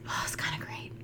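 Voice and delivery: unvoiced confiding whisper. Real, not acted. Woman admits to co-host in whisper, guilty whisper.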